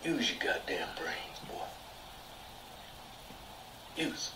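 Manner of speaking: whispered, slow and menacing; mock menacing whisper; whispered scold. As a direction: hiss it low at him.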